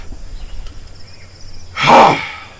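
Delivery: long, heavy, breathy huff; loud exasperated sigh. Acted. Breakbone sighs hard at the meddling adventurers, loud and fed up. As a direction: blow out all the frustration.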